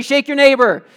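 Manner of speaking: loud, punchy congregation cue; call-out prompt. Shown as a demonstration. Pastor prompts the congregation to shout back, loud cue. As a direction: belt it out for them to echo. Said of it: "Tell me, somebody".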